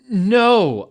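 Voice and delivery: high, loud mock scream. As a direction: cry out in horror. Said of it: "I screamed".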